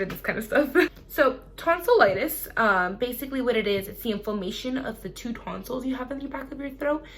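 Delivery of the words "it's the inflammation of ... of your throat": ordinary delivery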